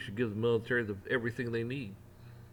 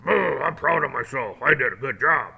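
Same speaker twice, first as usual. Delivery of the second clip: louder, sarcastic self-praise; smug mock pride; 3 semitones higher, 9 dB louder than usual. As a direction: gloat like a self-satisfied cop. Mocking proud officer.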